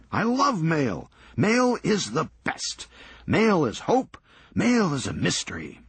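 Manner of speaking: high, gushing eccentric raving about mail; an overexcited man in love with his mail; giddy Serge. Acted. Serge gushes over the mail.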